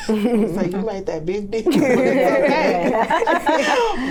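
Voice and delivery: stretched out in amused laughter; laughing. Real, not acted. Teacher laughing about toddlers making up, slow and loud. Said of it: "laughing at the kids".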